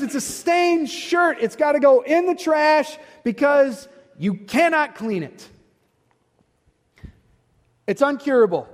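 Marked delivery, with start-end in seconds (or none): none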